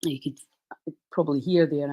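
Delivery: blocked nose voice; congested. Real, not acted. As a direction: speak through a stuffy nose. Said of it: "I'm much more congested".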